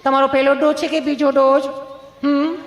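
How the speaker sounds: high, gentle nurse voice; sweet nurse. Retold or imitated. Nurse gently asks Gabho about his dose, in a high sweet voice. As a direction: put on a sweet-voiced nurse asking kindly. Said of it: "nurse બેને સહજતાથી એટલું પૂછ્યું".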